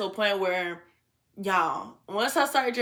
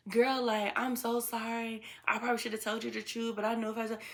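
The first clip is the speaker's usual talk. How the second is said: much softer, lightly voiced apology; retold apology; 9 dB quieter than usual. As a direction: imitate a friend saying sorry under her breath. Retells friend's texted apology.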